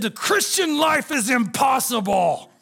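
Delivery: hammed-up sister's proclamation; imitating sulky sister. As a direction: drag it out like a dramatic teenager. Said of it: "she made a very vocal, bold proclamation".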